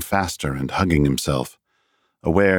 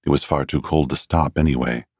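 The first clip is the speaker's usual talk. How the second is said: plain reading voice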